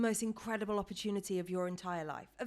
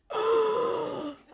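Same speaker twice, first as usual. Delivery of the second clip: sharp loud intake of breath; shocked gasp; 12 semitones higher, 8 dB louder than usual. Retold or imitated. Gasp on reading the text from her friend. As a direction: gasp as if reading the news again.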